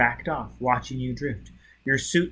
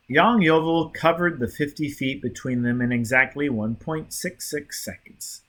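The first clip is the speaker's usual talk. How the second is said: ordinary delivery, nothing marked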